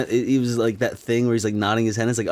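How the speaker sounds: normal talking voice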